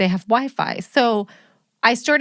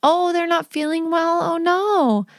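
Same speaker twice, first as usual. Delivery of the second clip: much higher, sugary polite voice; sweet phone voice; 8 semitones higher, slower than usual. Retold or imitated. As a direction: sound nice for the school nurse. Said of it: "and I'm like".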